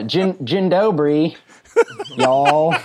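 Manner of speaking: hammed-up Polish hello capped with a Southern y'all; Polish greeting with Texas y'all. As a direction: joke greeting tacked onto a Texas twang. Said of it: "said something in German and then ended it with".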